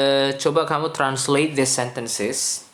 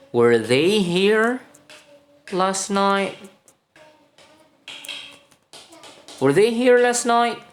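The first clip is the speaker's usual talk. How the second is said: slow, loud model question; 10 semitones higher, 4 dB louder than usual. Shown as a demonstration. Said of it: "teacher models the question".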